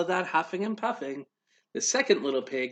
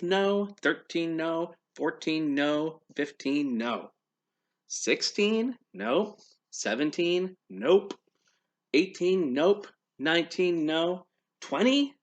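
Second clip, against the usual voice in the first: ordinary delivery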